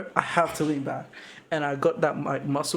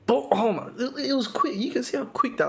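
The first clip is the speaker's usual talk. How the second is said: amazed; 9 semitones higher than usual. Real, not acted. Amazed at the elbow replay.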